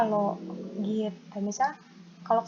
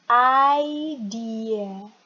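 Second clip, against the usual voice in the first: "idea" drawn out very slowly and loud; slow demo; 5 dB louder than usual. Shown as a demonstration. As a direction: stretch "idea" out for learners to copy. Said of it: "Yo, faster". ("idea" diucapkan pelan dan panjang)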